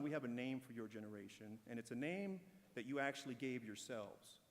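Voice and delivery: ordinary delivery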